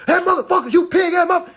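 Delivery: high, loud mock yell at the police; mock cussing out the cop. To the police officer, a hammed-up furious yell. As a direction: pretend to scream insults at the cop. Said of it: "Nah, I'm not doing none of that".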